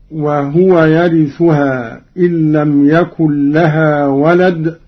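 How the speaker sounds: chanted recitation with the ha of وهو voiced as wahuwa; Quran recitation. Shown as a demonstration. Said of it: "قرأ قالون بإسكان الهاء".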